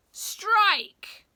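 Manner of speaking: loud, high playground yell; big excited call. A narrator's reading. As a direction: yell it like a kid refereeing. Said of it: "yelled a third".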